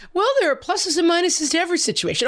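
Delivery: high mock-cheery husband voice. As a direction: send up an endlessly upbeat spouse, pitched high. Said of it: "He's like".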